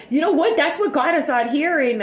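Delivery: usual speaking voice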